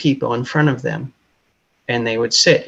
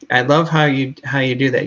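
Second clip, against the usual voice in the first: usual speaking voice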